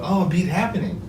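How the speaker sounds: mimicking a Japanese tourist in a Japanese accent. Retelling a tourist's words in a Japanese accent. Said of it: "in a Japanese accent".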